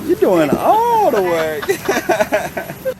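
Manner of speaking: drawn-out tease. Pretend. Drawn-out teasing.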